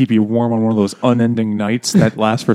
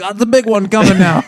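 high, loud mock heart attack; Sanford and Son; 9 semitones higher, 5 dB louder than usual. Old man faking a heart attack, hammed up. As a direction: holler as if the big one is hitting. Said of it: "like fucking Sanford and Son".

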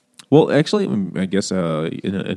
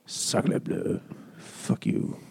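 mock French accent, hushed and defiant; lightly accented French spy, muttering; 2 semitones lower, 11 dB quieter, much slower than usual. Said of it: "He's French in my mind".

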